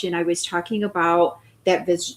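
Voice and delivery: ordinary delivery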